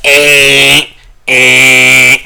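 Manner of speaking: loud, held buzzer noise; phone alert imitation. Podcaster mimics his phone's flood alert, loud blaring buzz. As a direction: imitate a blaring emergency alarm. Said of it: "message alert where it's like".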